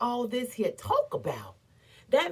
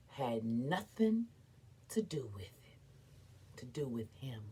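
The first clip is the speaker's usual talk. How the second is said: much lower, soft whisper; 11 semitones lower, 13 dB quieter than usual. Acted. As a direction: drop to a scornful whisper. Dismissing man's role.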